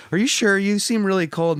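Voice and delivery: mocking imitation of a fake nice guy; mock fake-polite guy. Mocking guy's fake concern.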